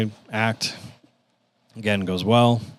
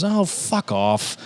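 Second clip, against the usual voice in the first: high, drawn-out blunt dismissal; Lisa Lampanelli; 8 semitones higher, much slower than usual. Retold or imitated. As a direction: brush off the excuse slowly, pitched up. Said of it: "she says it like it is".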